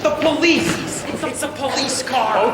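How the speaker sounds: alarmed warning. Acted. Warning the group the police have arrived, alarmed and loud. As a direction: burst in with bad news.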